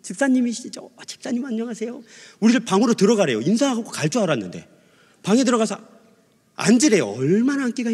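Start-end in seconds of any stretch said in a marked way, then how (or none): 0.0-2.0 high, soft, gentle hello; smiling greeting. Retold or imitated. Sunday school teacher greets student's mother, smiling and soft. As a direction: greet softly with a smile in the voice. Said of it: "선생님이 웃으면서".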